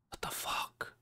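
breathless scared whisper under his breath. Real, not acted. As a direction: hush it so nobody in the room hears. Alone, spooked by open door.

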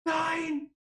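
high, breathy disbelieving no; shrieking jackpot winner. Real, not acted. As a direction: squeal in disbelief. Sees something special in box.

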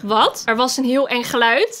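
ordinary delivery

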